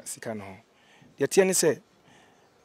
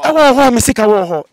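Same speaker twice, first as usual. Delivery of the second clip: loud outburst; 7 semitones higher, 12 dB louder than usual. Real, not acted. A man cheated of his money speaking up loudly. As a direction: voice rises and booms with frustration. Upset telling of lost money.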